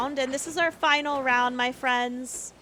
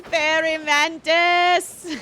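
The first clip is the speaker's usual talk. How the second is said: drawn-out call; 2 semitones higher, much slower than usual. Real, not acted. Calling out a bug spawn, drawn out.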